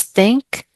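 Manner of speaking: think, said slowly with a rising tune; teacher modelling the word think for a student, slow and clear; slow stressed word. Shown as a demonstration. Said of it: "focus on these three words".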